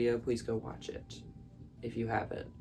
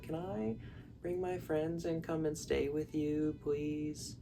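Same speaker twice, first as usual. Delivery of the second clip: higher, softer begging voice; pleading little girl; 5 semitones higher, 5 dB quieter, slower than usual. Retold or imitated. Girl begging her aunt by letter, in a high soft plea. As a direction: plead sweetly like a child.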